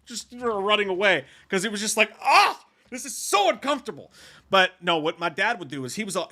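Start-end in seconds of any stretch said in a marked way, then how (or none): 2.2-4.1 high-pitched embarrassed outburst; acted-out mortified cry; mortified groan. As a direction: replay squirming childhood embarrassment, pitched well up. Acting out his embarrassment as a child.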